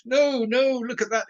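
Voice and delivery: high, drawn-out oh no; mock dismay. Writer rereading their old work aloud, mock dismay. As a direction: groan in playful horror at your own work.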